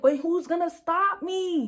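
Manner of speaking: high, slow, pointed dare; defiant challenge. Retold or imitated. To the neighbors crowding in, slow defiant dare. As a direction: dare the whole block to stop her.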